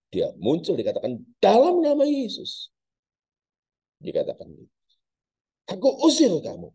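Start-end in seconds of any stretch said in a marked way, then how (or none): 1.4-6.7 commanding exorcism. Acted. Pastor casting out spirit.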